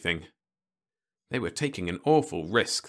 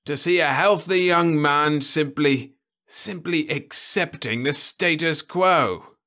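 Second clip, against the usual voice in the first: put-on higher, slow, louder old man's scorn; disgusted old man; 9 semitones higher, 6 dB louder than usual. Osmond scolds Ludovic for accepting things, slow and scornful. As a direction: lay the contempt on slowly.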